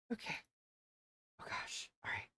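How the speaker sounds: breathy whispered "oh gosh" under her breath. Real, not acted. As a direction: whisper it so the stream barely hears. Whispering while mic is live.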